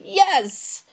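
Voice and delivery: high, drawn-out delighted yes; excited confirmation. Retold or imitated. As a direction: relive the thrilled agreement, pitch jumping up. Retold eager confirmation to actor.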